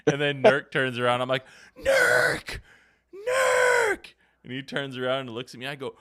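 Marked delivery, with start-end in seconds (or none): 1.8-4.1 loud, much higher shout of a name; calling out. Retold or imitated. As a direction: holler to get his attention. Retold calling to player.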